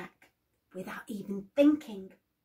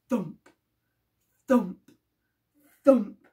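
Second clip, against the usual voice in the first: loud thumps; 2 semitones lower, 7 dB louder than usual. A narrator's reading. Giant footsteps in story.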